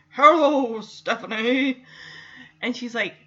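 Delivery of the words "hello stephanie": talking horse's drawn-out hello. Retold or imitated. Horse greets Stephanie at the stable, drawn-out talking-horse voice. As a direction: greet her as a talking horse, stretching the name. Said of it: "the horse is like".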